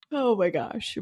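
sleepy yawn through the words. Real, not acted. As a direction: say it mid-yawn. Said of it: "I'm literally yawning".

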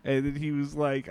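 ordinary talk

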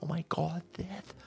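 hushed, breathy parody of a frightened liberal; mock scared whisper. Streamer mocking libtards over there, in a hushed, scared parody whisper. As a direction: whisper it like guns are terrifying.

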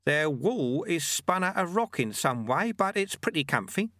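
put-on high-pitched, untroubled old monk; Lutze, easygoing monk.

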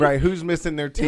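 joking echo with a laugh; giggling mock alarm. Real, not acted.

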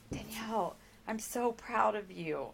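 a touch of a moved boss, gently proud; lightly voiced HR woman softly praising; tender soft praise.

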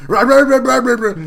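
loud high babbling rah rah; hammed-up fit of nonsense syllables; mock seizure noises. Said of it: "And then he had a seizure".

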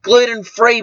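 usual speaking voice

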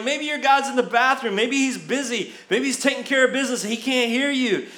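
high-pitched teasing jabs; playful acted-out heckle; mock taunting. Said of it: "it just gets them all worked up".

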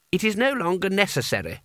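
high, measured pronouncement; commanding and declamatory; imperious boy wizard. A narrator's reading. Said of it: "said Coin".

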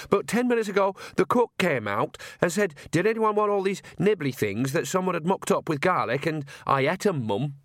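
a hint of a simple, apologetic lad; lightly voiced sheepish son. Son confessing to Mum he ate the garlic nibbles, sheepish.

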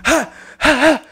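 high, short "ha" bursts; punching noises. Pretend. Showing kidney shots on a bear, mock fighting cries. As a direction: throw imaginary body blows with sharp ha's.

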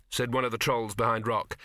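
usual speaking voice

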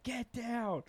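high-pitched imitation of a teacher's yell; retold teacher's scream. Teacher ordering a climber off the pillar, imitated scream. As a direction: screech it like an alarmed teacher. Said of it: "this teacher fucking screams out".

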